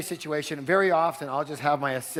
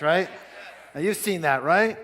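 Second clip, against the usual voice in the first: ordinary talk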